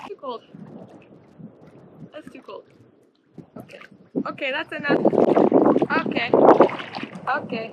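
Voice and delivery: squeaky cold complaints; in ice cold water. Real, not acted.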